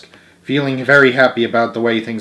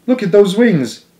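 amazed teacher; 9 semitones higher, 4 dB louder than usual. A narrator's reading.

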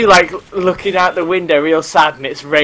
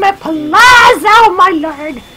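high, loud, drawn-out wail; hammed-up cry of agony; mock anguished cry; 6 dB louder, slower than usual. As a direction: wail it out slowly at high pitch. Mock outburst after hurting himself.

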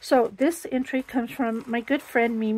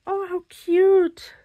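high, loud gush over a cute card; delighted squeal; 7 semitones higher, 6 dB louder, slower than usual. Real, not acted. Opening a gift from a friend.

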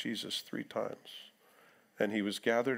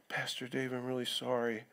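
softer, hesitant apologetic voice; timid apology; 2 semitones lower, 6 dB quieter than usual. Retold or imitated. Congregant knocking on pastor's door, softly apologetic. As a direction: tiptoe in sheepishly, sorry to intrude.

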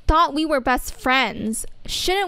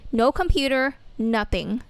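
normal talking voice

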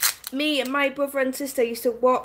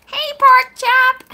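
silly put-on voice; 12 semitones higher, 8 dB louder, much slower than usual. Playful accent bit, laughs after.

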